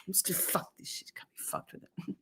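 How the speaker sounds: whispered, fast give-up under the breath. Real, not acted. Streamer giving up on her mouse, whispering to herself. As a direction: whisper it to yourself in defeat.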